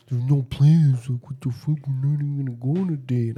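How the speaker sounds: put-on mocking friend voice; Rob. Friend denying date plans, mocked in put-on voice. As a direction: imitate a friend's defensive denial in a silly voice. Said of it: "Rob's like".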